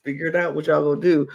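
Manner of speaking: lightly voiced offhand player; casual announcement. Imagining the player's better move, mild offhand delivery. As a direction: toss it out like no big deal. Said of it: "he could have played it like".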